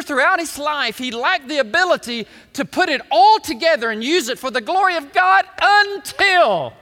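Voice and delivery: ringing pulpit crescendo that eases at the end; full-force preaching. Real, not acted. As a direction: preach it at the top of your voice.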